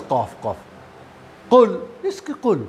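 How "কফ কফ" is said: কফ low and throaty; নিচু গলায় কফ; mock forced kaf. Shown as a demonstration. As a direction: mimic a strained কফ. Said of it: "আরে কি আওয়াজ".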